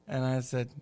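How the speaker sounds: ordinary talk, nothing marked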